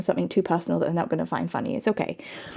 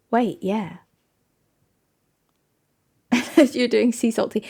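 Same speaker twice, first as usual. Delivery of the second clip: ordinary talk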